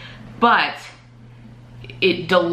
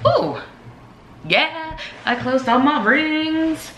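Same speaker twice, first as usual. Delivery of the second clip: excited; 11 semitones higher, slower than usual. Real, not acted. Sudden delight at closed rings.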